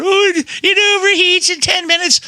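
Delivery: high, nasal mock of the repair guy's excuse; mocking whiny voice. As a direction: whine it like a lazy excuse-maker. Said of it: "he's a hack".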